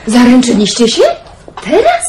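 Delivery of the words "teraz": high, leaping question; astonished. Acted. Basia hears of the engagement and leaps up in astonishment. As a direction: jump up in astonishment.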